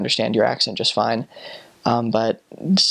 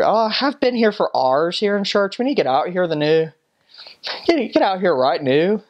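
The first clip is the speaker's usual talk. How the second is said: high, lilting Northern Irish complaint; Northern Irish accent; 6 semitones higher than usual. Acted. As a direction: grumble in a put-on Northern Irish brogue. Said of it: "they end everything with".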